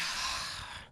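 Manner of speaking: long, soft exhale; acted weary sigh; resigned sigh. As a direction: sigh as a parent giving in. Dad gives in to daughter.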